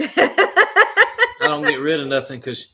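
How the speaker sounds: high, loud, slow laughing retort; laughing protest. Real, not acted. Teased about never getting rid of things, laughing. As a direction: protest loudly through laughter.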